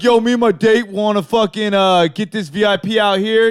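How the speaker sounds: put-on party-bro bluster; mock bro voice. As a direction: imitate a swaggering dude, pushed loud. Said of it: "tries to be the dude".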